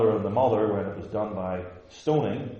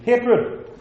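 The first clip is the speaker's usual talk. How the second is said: high, loud, scandalized objector; hammed-up outrage, pitched way up; mock outraged shouting; 12 semitones higher, 8 dB louder than usual. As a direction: cry it out in horrified indignation. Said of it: "they probably would have shouted it out".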